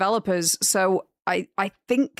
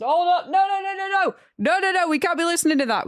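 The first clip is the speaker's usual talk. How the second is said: higher, rapid alarmed refusals; urgent no; 8 semitones higher than usual. Real, not acted. Streamer halting copyrighted music, higher and alarmed. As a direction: stop the music now.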